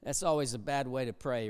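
normal talking voice

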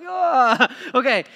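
high, loud, exaggerated "Ah" of disbelief; mock surprise. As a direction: act stunned, then laugh it off. Reacting to silly dating advice.

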